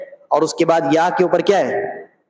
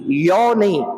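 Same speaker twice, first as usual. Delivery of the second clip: ordinary talk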